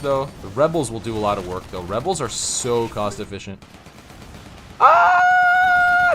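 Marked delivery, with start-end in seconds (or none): 4.8-6.1 high startled yelp of alarm; sudden shout. Real, not acted. Caster watching a teammate die, a startled high yelp. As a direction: cry out in shock as the unit falls. Player's unit about to die.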